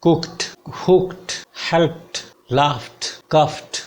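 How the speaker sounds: कुक्ड, हुक्ड said high, with a sharp t at the end; crisp t endings. Shown as a demonstration. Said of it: "examples".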